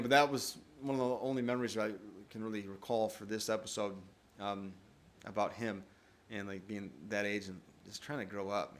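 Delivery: usual speaking voice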